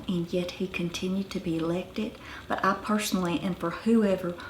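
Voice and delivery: put-on softly quoted Ozark mountain accent; Ozark woman's accent. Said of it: "a musician woman I met was saying".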